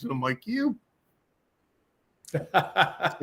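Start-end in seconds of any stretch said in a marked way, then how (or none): 0.5-0.8 a squeaky, much higher "You."; mock shock. Pinned to the ground and reacting to the hypnotist, with a squeaky mock-shocked "You.". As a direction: yelp it out in comic disbelief.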